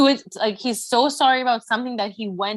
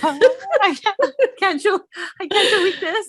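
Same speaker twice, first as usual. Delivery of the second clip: high, loud whimpering protest; whiny crying daughter; 11 semitones higher, 4 dB louder than usual. Pretend. Daughter whining at mom Dolores, high and tearful. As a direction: whine it like a cornered kid about to cry.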